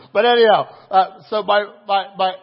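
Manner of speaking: real laugh breaking loud. Real, not acted. Pastor cracking up at his own story, laughing loud. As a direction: laugh it off loudly.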